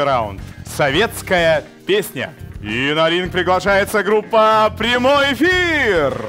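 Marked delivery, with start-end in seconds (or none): 2.6-6.2 high, drawn-out ring announcer call; boxing ring announcer. Acted. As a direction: stretch the band name like a fight intro. Calling band onto the ring.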